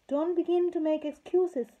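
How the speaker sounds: high, loud scolding; wicked fairy scolding the queen. A narrator's reading. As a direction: wag a finger and scold her.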